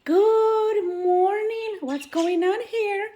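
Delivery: high, sweet voice for the birds; pet talk voice. Acted. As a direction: coo at the birds like they're babies.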